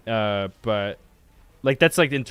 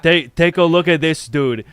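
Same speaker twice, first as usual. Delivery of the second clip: louder, eager take a look at this; louder quote; 5 semitones higher, 9 dB louder than usual. Retold or imitated. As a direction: push it out keen and loud. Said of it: "I was like".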